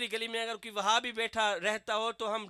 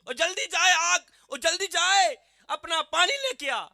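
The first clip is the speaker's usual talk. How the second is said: loud, higher barking of commands at a servant; bossy Bedouin orders; 7 semitones higher, 8 dB louder than usual. Acted. Preacher voicing the Bedouin ordering Umar to fetch water, loud and bossy. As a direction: order him about roughly. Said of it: "حکم چلانے شروع کر دیے".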